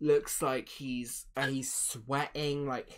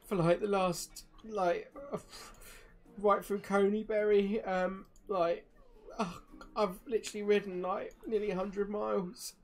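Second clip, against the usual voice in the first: higher, halting exhausted voice; shaken, exhausted messenger; 6 semitones higher, 5 dB louder than usual. Acted. Young rider reports after four days riding, drained and rattled. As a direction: falter through it, utterly spent. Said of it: "he looks quite shaken up".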